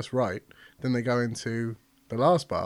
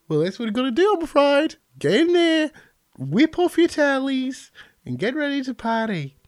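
higher, louder Australian receptionist, hammed up; a chirpy Australian receptionist ordering them to strip, upbeat; 13 semitones higher, 6 dB louder than usual. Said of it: "Yeah, she's Australian".

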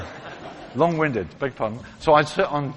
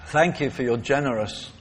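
closing prayer. Acted.

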